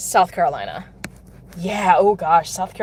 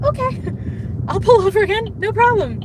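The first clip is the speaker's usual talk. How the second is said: mock cheerful; 7 semitones higher than usual. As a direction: say it like a sing-song, chirpy helper. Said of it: "I'm gonna be like".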